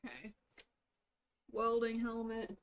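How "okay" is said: normal talking voice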